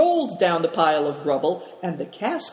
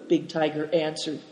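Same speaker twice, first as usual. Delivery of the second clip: ordinary delivery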